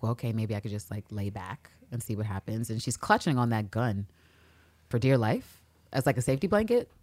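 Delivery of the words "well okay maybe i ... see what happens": lower, softer giving in; soft hesitant. Retold or imitated. Villanelle giving in on the bed, lower and softer. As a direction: let it trail off. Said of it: "And it's like".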